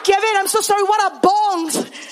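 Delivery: high, loud acted confusion; comically lost pastor; baffled apology. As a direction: plead for an explanation, slowing on the question. Clueless pastor asks once more.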